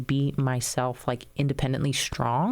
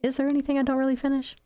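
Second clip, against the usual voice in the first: high, quick wondering voice; retold puzzled thought, pitched up; self-questioning; 11 semitones higher, much faster than usual. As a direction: ask yourself in a raised, hurried musing tone. Retelling her doubting question to herself.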